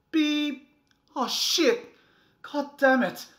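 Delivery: very loud, high screams; furious screaming. Acted. Parent screaming when the child wakes during the movie. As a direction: explode at the woken kid.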